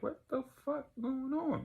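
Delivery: very high-pitched, baffled question; acted bewilderment. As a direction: squeal it in mock confusion. Acts out snapping back to reality.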